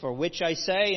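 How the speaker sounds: normal talking voice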